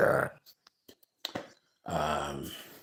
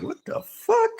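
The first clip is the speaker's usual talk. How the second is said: high, squeaky stunned exclamation; stunned disbelief. Retold or imitated. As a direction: blurt it in shock, pitch shooting up. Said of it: "And I'm like".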